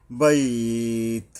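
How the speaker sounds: বাইত held slow, ই drawn two beats; slow held pausal বাইত; long stop. Shown as a demonstration. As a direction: stretch বাইত at the pause. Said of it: "দুই আলিফ পরিমাণে টানতে হবে এখানে থামতে গেলে".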